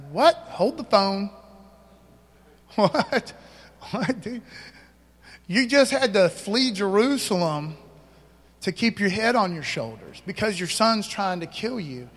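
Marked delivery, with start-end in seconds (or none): 0.1-7.8 hammed-up disbelief with a laugh; mock surprise. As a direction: act baffled at the verse.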